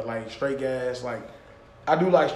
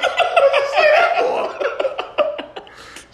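very high, drawn-out squeal of laughter; laughing imitation; much slower than usual. Host imitating a stunned onlooker, wheezing high with laughter. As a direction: squeeze the mock question out through helpless laughter.

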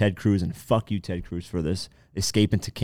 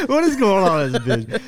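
laughing; 11 semitones higher, 4 dB louder than usual. Real, not acted. Laughing about confused camels.

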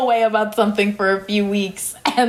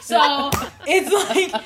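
high, laughing "what"; laughing incredulously about a coworker's message; laughing disbelief; 7 semitones higher, slower than usual. Real, not acted. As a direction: crack up in disbelief. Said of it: "It was so funny".

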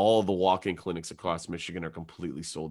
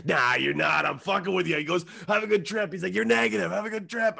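high, louder teasing send-off; breezy, hammed-up reassurance, pitched up; jovial prank reveal; 9 semitones higher, 3 dB louder than usual. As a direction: imitate a doctor gleefully dropping the joke. Doctor gleefully reveals his prank.

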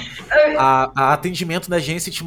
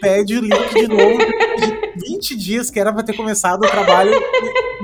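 laughing indignation; 4 semitones higher, 4 dB louder than usual. Real, not acted. Indignant about client, laughing.

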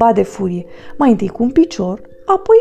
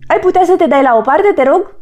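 asking; 6 semitones higher, 4 dB louder, faster than usual. Acted. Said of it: "îl întreabă trolul".

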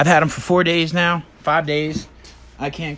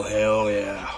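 Stone Cold impression; 4 semitones lower than usual. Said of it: "Every day I go".

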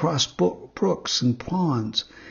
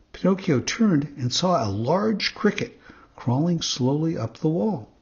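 ordinary delivery

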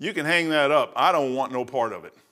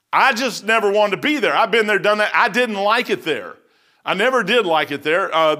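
raised, louder pulpit voice; a revival preacher pressing hard; preaching; 6 semitones higher, 4 dB louder than usual. Acted. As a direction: drive the point home to the congregation. Preaching at full force.

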